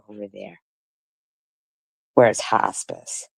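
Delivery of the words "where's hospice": low, slow sneer on "Where's hospice?"; slow mocking echo. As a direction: sneer it out slowly once more. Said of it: "repeats again".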